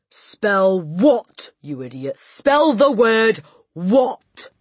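loud, exasperated order, very slow at the end; Trunchbull. A narrator's reading. Headmistress losing patience with the boy, slow shout. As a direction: bellow it out word by word.

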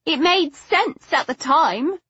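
high-pitched, earnest justification; insistent. A narrator's reading. Daughter defending her father's plan to Stoker, insistent. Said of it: "she insisted".

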